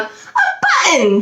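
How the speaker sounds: put-on high, drawn-out little-girl exclamation; excited cry. A narrator's reading. Little girl spotting something on the ground, high excited cry. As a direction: cry out high, stretching the word. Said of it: "she cried".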